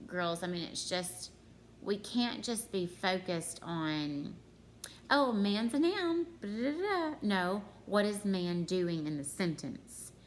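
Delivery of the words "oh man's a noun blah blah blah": higher, louder sing-song parody; mock glib recitation. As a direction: rattle it off as empty chatter, pitch climbing. Mimics thoughtless labelling of words.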